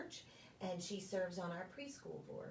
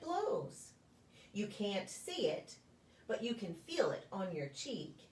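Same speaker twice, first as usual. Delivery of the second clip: gentle, sing-song picture-book reading with a lilting refrain; reading to children; 3 semitones higher, 4 dB louder than usual. Acted. Preschool story time reader to the children, gentle storybook voice. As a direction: read it like story time, drawing the little ones in.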